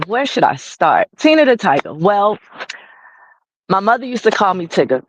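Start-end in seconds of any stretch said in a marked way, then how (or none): none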